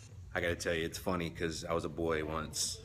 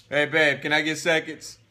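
calling wife for more; 9 semitones higher, 11 dB louder than usual. Real, not acted. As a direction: call to the other room, loud and high.

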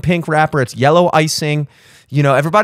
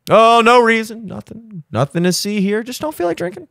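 evasive excuse; 6 semitones higher than usual. Retold or imitated. Acting evasive excuse at event.

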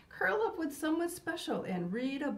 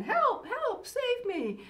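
princess crying for help; 10 semitones higher, slower than usual. Acted. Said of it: "the princess is saying".

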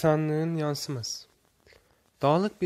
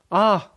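short "ah", higher and much louder; cry of pain; 6 semitones higher, 9 dB louder than usual. A narrator's reading. Boy stubs his foot on a stone, cry of pain. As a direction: yelp it out in pain. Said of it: "can acısıyla".

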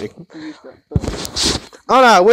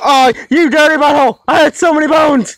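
high, loud outburst at the game; worked-up shout; 10 semitones higher, 5 dB louder than usual. Real, not acted. Player rages over lost bones in the game, worked-up shouting. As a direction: yell it in high-pitched outrage. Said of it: "Calm down there, killer".

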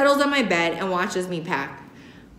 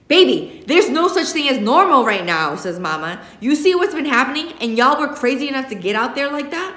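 higher, exasperated scolding; exasperated mother; 6 semitones higher, 4 dB louder than usual. A narrator's reading. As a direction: scold the kids out of worry. Worried mother lectures.

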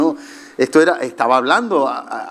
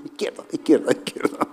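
stubborn muttering; 7 dB quieter than usual. Retold or imitated. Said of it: "y tú".

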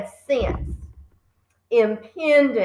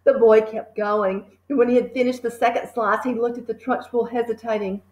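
plain reading voice

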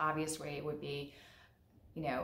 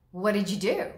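louder, demanding question; accusing parent; 3 semitones higher, 9 dB louder than usual. Acted. Parent questioning a child who came home crying, accusing. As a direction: confront the child as a stern parent.

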